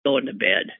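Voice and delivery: 3 a defeated shrug in the voice; 1 resigned. Retold or imitated. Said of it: "I thought".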